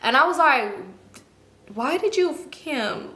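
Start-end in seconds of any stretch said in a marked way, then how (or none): 1.7-3.1 slow, softened, higher-pitched question; pointed questioning. Retold or imitated. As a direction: draw the question out in disbelief, quietly. Confronting her friend, first take.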